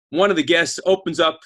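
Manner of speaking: ordinary talk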